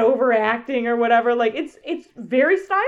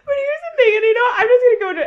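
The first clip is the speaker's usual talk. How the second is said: words squeezed out high and loud through laughter; laughing while talking; 13 semitones higher, 9 dB louder, faster than usual. Real, not acted. Still laughing as she starts her hot take.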